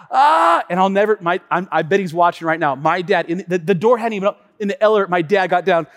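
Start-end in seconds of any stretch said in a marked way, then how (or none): 0.1-0.6 high, loud gasp of dismay; caught-out gasp. Retold or imitated. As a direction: gasp as you realize you were caught. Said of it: "He was like".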